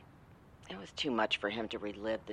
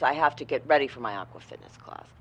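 ordinary talk

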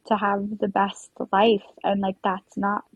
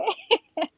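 teary laugh; 12 semitones higher than usual. Real, not acted. Laughing through tears recounting stress.

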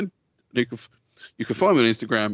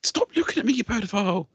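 mock shriek; 7 semitones higher than usual. Mock insult.

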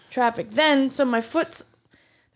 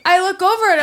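fast and laughing, higher and louder; 7 semitones higher, 5 dB louder than usual. Real, not acted. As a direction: rush it out through laughter. Laughing while retelling the fight.

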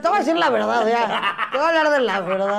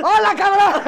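put-on drunken shout; 9 semitones higher, 5 dB louder than usual. Imitating a drunk greeting.

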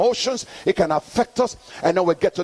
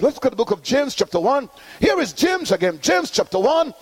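high, ringing call-and-response preaching; full-force preaching; 6 semitones higher than usual. Acted. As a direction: drive the congregation to answer back. Preaching to congregation.

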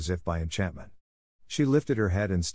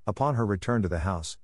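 ordinary delivery

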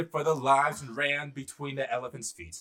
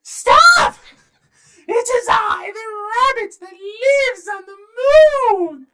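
high, loud squeaky little rabbit shout; tiny rabbit's shrill command, words drawn out; 15 dB louder, slower than usual. Acted. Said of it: "he said in his rabbit voice".

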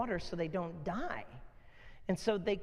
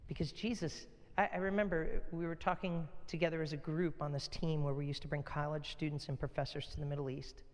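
ordinary talk

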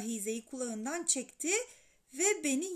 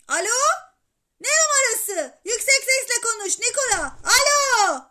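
high, very loud demand down the phone; insistent boyish bellow; shouting; 10 semitones higher, 14 dB louder than usual. A narrator's reading. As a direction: yell to be heard on the line. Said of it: "Alcest yine bağırmaya başladı".

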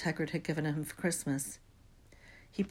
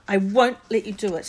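defiant; 3 semitones higher, 10 dB louder than usual. Acted. Neville defiant stand.

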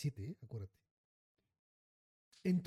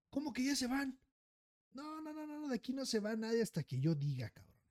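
angry boss voice; 8 semitones higher than usual. Retold or imitated. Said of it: "el tipo de, de, el del cámara".

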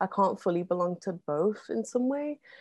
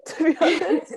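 laughing it out, pitched up; nervous laugh through the words; a real laugh breaking into the line; 10 semitones higher, 7 dB louder than usual. Real, not acted. Nervous laugh at terrifying work.

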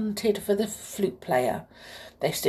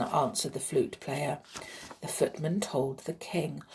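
ordinary delivery

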